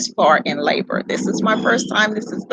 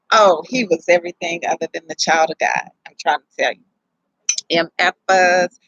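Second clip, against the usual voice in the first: normal talking voice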